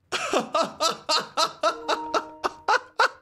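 laughing it off. Real, not acted. Streamer laughs at the chaos in his game, amused. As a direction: chuckle through the disbelief. Reacting to the wild finish.

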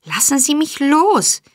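high, struggling protest; protesting cry. A narrator's reading. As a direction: call out as if fighting free. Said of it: "rief Oliver sich wehrend". (sich wehrend gerufen)

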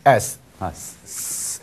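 "S" as a long hissed sss; dental fricative. Shown as a demonstration. Teacher demonstrates sigma's sound, tooth-friction "S". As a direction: hiss "S" through the teeth, no voice. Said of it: "的一个的摩擦音".

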